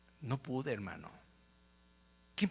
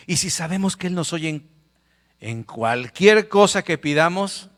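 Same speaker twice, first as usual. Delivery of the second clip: loud, high preacher's proclamation; preaching at full force; 4 semitones higher, 11 dB louder than usual. Acted. Preacher reads scripture aloud to the congregation, loud and high. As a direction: proclaim the verse to the church.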